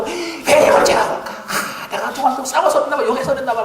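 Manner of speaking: high, fast, laughing mock worry; mock fretting. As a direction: fret like a nervous believer, mocking. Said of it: "이런 식으로 생각한단 말이에요". (걱정하는 신자를 높고 빠르게 흉내)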